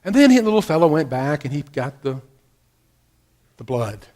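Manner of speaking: normal talking voice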